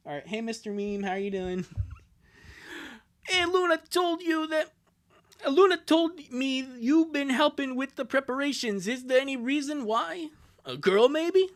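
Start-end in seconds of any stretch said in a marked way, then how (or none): 3.3-11.5 higher-pitched sister voice, prying; teasing older sister. Acted. Sister asking brother about a girl, teasing. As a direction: play a sister fishing for a secret.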